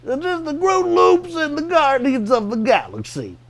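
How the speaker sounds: high sing-song Cosby voice; Bill Cosby voice. Retold or imitated. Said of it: "Or I'll go like".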